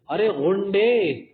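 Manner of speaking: loud, high, slow call of insult; loud drawn-out ارے غنڈے; mock scolding shout. Shown as a demonstration. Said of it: "غنڈے کا ہمیں پڑھ دے".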